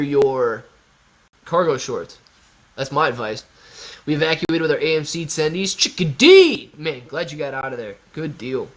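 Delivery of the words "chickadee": louder, higher call of the name; a bright shouted greeting; excited call-out. Real, not acted. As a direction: hail Chickadee warmly. Calling out a viewer's name.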